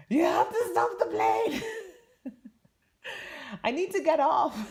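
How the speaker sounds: high, loud, half-laughing panic; dramatic panicked plea. Retold or imitated. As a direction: beg the crew in a laughing frenzy. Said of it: "that was so dramatic".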